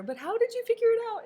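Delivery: high, fast, eager question as the curious lady; eager Mrs Gardner. Retold or imitated. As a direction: pitch it up and rush it like an excited guest. Voicing Mrs Gardner asking Poirot.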